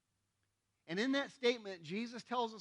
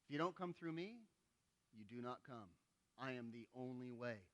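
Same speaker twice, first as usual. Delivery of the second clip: much softer, lower, grave delivery; solemn hush; 7 semitones lower, 9 dB quieter than usual. Acted. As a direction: drop the voice to a solemn near-hush. Jesus' solemn closing words.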